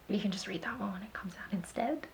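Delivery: whispered cheeky aside. Real, not acted. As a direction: drop to a whisper. Joking aside.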